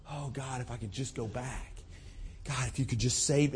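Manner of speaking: soft, hushed desperate prayer; quiet imitated pleading prayer; hushed plea. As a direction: barely voice the plea to God.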